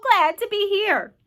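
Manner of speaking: high, loud, glad call; boy's delighted shout. A narrator's reading. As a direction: call down happily to everyone. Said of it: "James called out".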